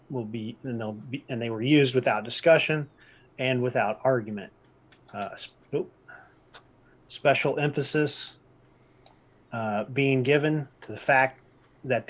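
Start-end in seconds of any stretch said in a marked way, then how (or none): none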